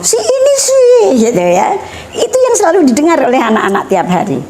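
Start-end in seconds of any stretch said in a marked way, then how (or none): 0.0-0.9 high-pitched imitation of someone disparaging others; mock sneering. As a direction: mimic a gossip's fault-finding, pitched way up. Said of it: "modo itu mencela". (menirukan orang mencela dengan nada tinggi)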